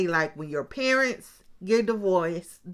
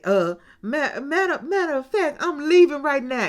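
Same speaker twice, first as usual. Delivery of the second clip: higher, louder abrupt declaration; leaving now; 7 semitones higher, 6 dB louder than usual. Retold or imitated. Bride quitting the show on the spot, higher and louder. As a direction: blurt it out and storm off.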